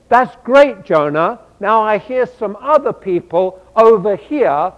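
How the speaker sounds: a hint of God, upbeat and pressing; God cheerfully nudging Jonah toward other oppressed people; voicing God. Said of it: "And then God says to him".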